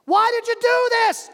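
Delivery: high, loud demand; shouting down. Acted. Man on bridge shouts the question again.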